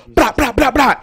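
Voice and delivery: loud, high rapid-fire vocal bangs; machine-like volley of shouted syllables; imitated gunshots. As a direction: mimic a burst of gunfire. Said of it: "and he goes".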